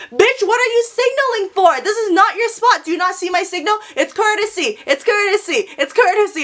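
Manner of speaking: high-pitched, indignant mock yell; retold yelling. Yelling out the car window at a signaling driver, indignant high yell. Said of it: "I yell that over and over".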